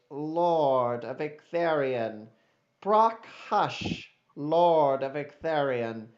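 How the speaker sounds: loud, slow ceremonial announcement; stately court introduction, ringing out to the hall; Eros as formal herald. Acted. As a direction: proclaim each title grandly, drawing out the names.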